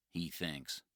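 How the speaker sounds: plain reading voice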